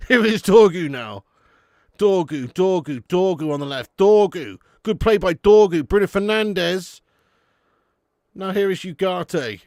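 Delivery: loud play-by-play on a pass; mock commentary. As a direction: call the action like a TV commentator. Mock live commentary on match.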